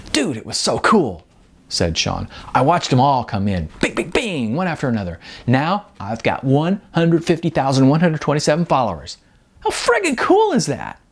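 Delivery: a thrilled musician boasting to his friend; excited bragging Sean. Acted.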